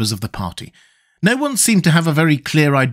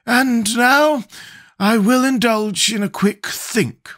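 slow, higher, relieved scarecrow; 10 semitones higher, slower than usual. Acted. Scarecrow giving up the crown, slow higher voice.

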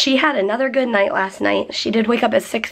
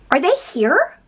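gasp, then a rising, higher question; startled; 6 semitones higher than usual. Real, not acted. Owner thinks the puppy's new family arrived, startled. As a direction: catch your breath and ask in surprise.

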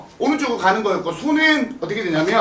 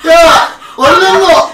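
loud, high shout; impatient yell; 7 semitones higher, 9 dB louder, much slower than usual. Real, not acted. Teacher hurrying the pupils along, high loud yell.

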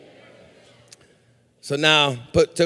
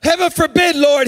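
high, ringing protest; loud exclamation; 8 semitones higher than usual. Retold or imitated. Preacher quotes Peter to Jesus, loud exclamation. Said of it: "the reason I said it loud like that".

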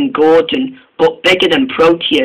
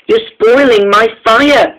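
higher, louder, slow whine; a child's whine at the fireman; complaining; 6 semitones higher, 4 dB louder, slower than usual. A narrator's reading. Engine whining at the fireman spoiling his fire. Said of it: "complained Neville".

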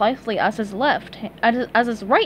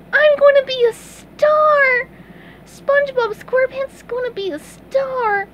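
lost in a daydream of fame; wistful squeaky cartoon sponge; dreamy sponge; 4 dB louder than usual. A narrator's reading. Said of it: "he said dreamily".

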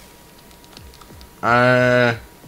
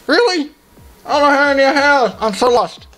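frantic; 3 dB louder than usual. Real, not acted. Frantic game play, falling behind.

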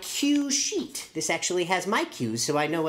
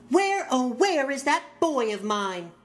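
calling mom; 7 semitones higher than usual. Acted. Mom calling for her son.

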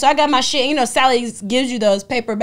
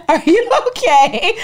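concerned clerk; 8 semitones higher, 6 dB louder, slower than usual. Retold or imitated. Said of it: "He's like".

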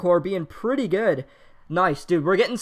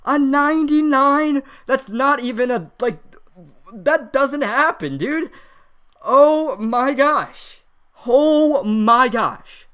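slow, drawn-out, breathless awe; stunned disbelief; 3 semitones higher, 3 dB louder, much slower than usual. Real, not acted. Streamer still reeling from the pull, stunned and slow. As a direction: let the shock sink in, slowly.

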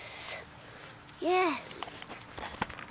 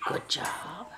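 whispered praise; 9 semitones lower, 6 dB quieter than usual. Real, not acted. Quiet praise to dog.

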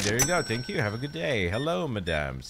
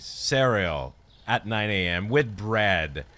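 normal talking voice

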